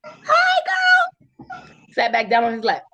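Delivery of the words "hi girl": very high, loud sugary hello; fake sweet greeting. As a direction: greet the rival with mock delight. Fake-friendly greeting to the rival.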